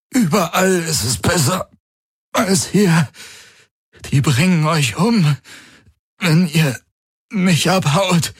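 strained urgent shout. A narrator's reading. Galen urging Kirai and Hawks to flee, strained shout. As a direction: shout it through the pain, desperate. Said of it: "Galen urging escape, strained".

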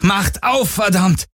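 high, urgent yell at a locked door; desperate shout. Acted. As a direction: pound the door with your voice.

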